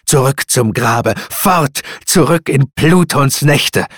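loud, high outburst of banishment; crying out. A narrator's reading. Narrator banishes the raven to Pluto's night, crying out. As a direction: shout the bird away. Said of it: "schrie ich auf".

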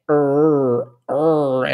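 "er" with a strong rolled country R; slow, low demo of the Paraná interior R; forced interior R. Shown as a demonstration. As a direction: give "er" a heavy interior-style R. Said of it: "é um R bem forçado".

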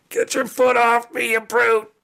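high-pitched angry little creature; indignant kobold. Acted. Pinned kobold to the cat standing on it, indignant.

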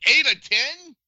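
jumps high on eight in alarm; incredulous, pitch leaping up; shocked disbelief. Real, not acted. Reacting to number of strangers.

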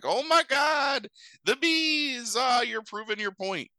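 high-pitched, drawn-out cry of alarm; hammed-up terrified delegates; mock panic. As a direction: wail it way up high like a panicking crowd. Acts out panicking delegates as bees escape.

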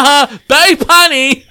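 high loud laugh; cracking up at a joke. Real, not acted. Laughs at the accent.

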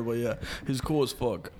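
usual speaking voice

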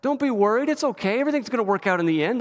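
high, gentle reassuring voice, a touch hammed-up; playful imitation of a gentle consoler; mock comforting angel. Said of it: "That's not what he does".

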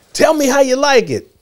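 high bright grown-up; cheerful director. Retold or imitated. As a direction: prompt the kid playfully. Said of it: "he said".

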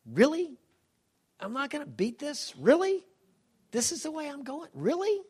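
high incredulous "Really?", voice jumping up on each one; disbelieving. Retold or imitated. Husband retelling his dying wife's disbelief, incredulous high voice. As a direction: echo her stunned disbelief, pitched high and loud. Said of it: "she kinda kept like".

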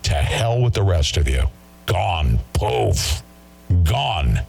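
ordinary delivery

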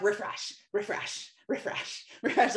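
rapid whispered refresh, ending in a laugh; compulsive refreshing. Retold or imitated. Mocking herself refreshing her phone like an addict. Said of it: "checking my phone like a crackhead".

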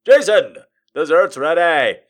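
loud calling-out father; shouting dad. Acted. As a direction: call him in from across the house. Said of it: "he heard his father shout".

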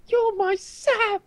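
very high, very slow tearful cry; mock anguished wail. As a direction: drag it out in hammed-up grief. Acts out Frodo and Sam death scene.

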